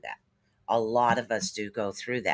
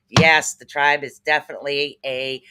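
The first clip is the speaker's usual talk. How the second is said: normal talking voice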